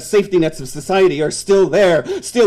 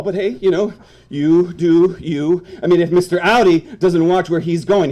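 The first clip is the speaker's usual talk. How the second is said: usual speaking voice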